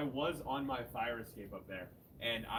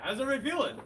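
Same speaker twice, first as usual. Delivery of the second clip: loud, raised hype-up shout; calling to the crowd; 10 semitones higher, 6 dB louder, much faster than usual. Real, not acted. Host greeting the audience, calling out loud. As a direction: get the crowd cheering.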